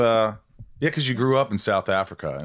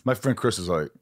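normal talking voice

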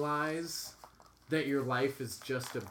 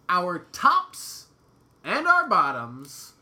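loud, drawn-out show announcement; announcer emphasis; 3 semitones higher, 10 dB louder, much slower than usual. Acted. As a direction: boom out the theme. Announcing the theme.

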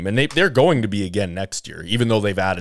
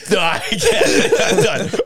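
giggly; 10 semitones higher, 3 dB louder than usual. Real, not acted. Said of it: "You flustered me".

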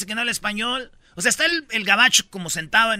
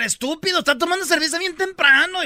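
mock yelling mother; 9 semitones higher, 5 dB louder than usual. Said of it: "le empieza a gritar".

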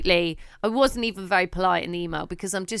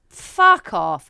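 blunt retort; 3 semitones lower, 8 dB louder than usual. Real, not acted. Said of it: "reacting to the request".

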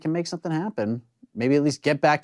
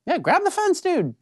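yelling at the screen; slower than usual. Real, not acted. Yelling advice at fighter on screen.

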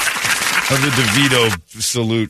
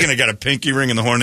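ordinary delivery

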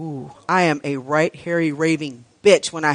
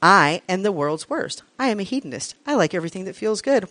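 normal talking voice

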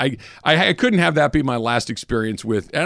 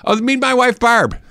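put-on Midwestern accent, pitched much higher and louder; 10 semitones higher, 4 dB louder than usual. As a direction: put on a cheery Midwestern accent to introduce the wife. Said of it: "That's how they say it".

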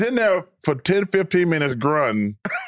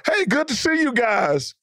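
higher-pitched mock cheery hello; retold jovial greeting; 8 semitones higher than usual. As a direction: imitate him greeting everyone breezily. Retells Tommy coming out greeting.

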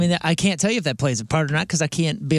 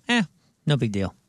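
low, softened verbal shrug; acted easygoing indifference; dismissive; 8 semitones lower than usual. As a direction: wave it off as nothing, dropping the pitch. Imagined shrugging reaction to the theft.

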